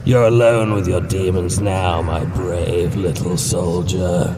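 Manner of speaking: slow, gloating demonic voice; taunting creature voice. Acted. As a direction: drawl out each cruel word.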